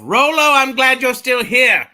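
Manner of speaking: high, loud, slow greeting; shouted happy greeting; excited shout. Real, not acted. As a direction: call out his name with joy. Greeting friend in chat.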